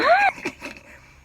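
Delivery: high squealing laugh; loud high giggle; burst of laughter. Real, not acted. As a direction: laugh it out, delighted. Laughing at her childhood love.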